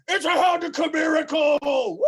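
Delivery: high, loud jubilant shout; full-voiced festive cheer; cheering orcs. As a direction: whoop like a crowd of orcs at a miracle. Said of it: "all of the orcs go".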